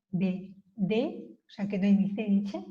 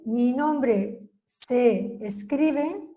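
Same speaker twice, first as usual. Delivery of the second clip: very slow repeat; much slower than usual. Shown as a demonstration. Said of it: "¿cómo se escribe?".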